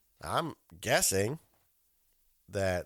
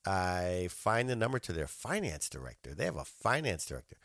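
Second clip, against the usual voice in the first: ordinary delivery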